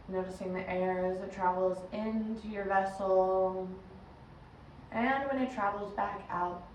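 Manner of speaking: measured meditation guide, long pauses between phrases; guided meditation. Acted. Yoga teacher guiding listeners into breath and body, guided meditation. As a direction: lead them inward unhurriedly, letting each instruction settle.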